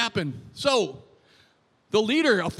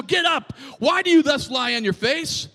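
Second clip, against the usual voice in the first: loud, raised reading of a rebuke; forceful command; 4 semitones higher, 4 dB louder than usual. Acted. Preacher rereads the Lord's words to Joshua, forceful command. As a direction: order him up sharply.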